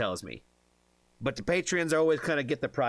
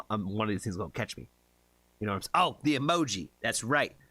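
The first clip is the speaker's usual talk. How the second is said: ordinary talk, nothing marked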